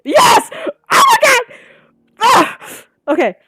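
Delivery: very high, loud scream of joy; thrilled yell; ecstatic scream. Real, not acted. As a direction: shriek with real relief. Relief on seeing the scene.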